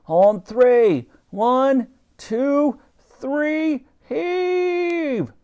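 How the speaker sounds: high, rising counted command; Admiral's count. A narrator's reading. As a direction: lead the crew in the pull.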